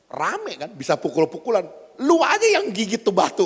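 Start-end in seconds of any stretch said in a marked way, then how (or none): 2.0-3.5 loud, high, quick comeback; mock angry retort. Preacher acting out a dialect mix-up, mock angry. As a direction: throw it back as if ready for a fight.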